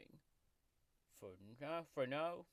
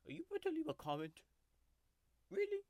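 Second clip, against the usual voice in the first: much higher and louder, fast; mock indignant yelp; indignant outburst; 10 semitones higher, 6 dB louder, much faster than usual. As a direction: snap at the viewer in mock outrage. Scolding the viewer.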